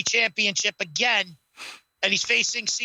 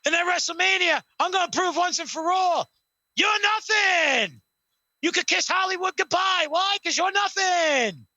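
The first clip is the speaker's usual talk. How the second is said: hammed-up Rock promo voice; Rock impression; 8 semitones higher than usual. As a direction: lord it over Cena like The Rock. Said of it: "The Rock goes".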